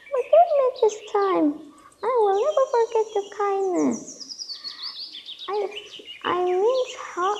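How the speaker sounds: high, pleading little voice; frightened mouse. Acted. Tiny mouse promising the lion a good turn, high pleading. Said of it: "said the frightened mouse".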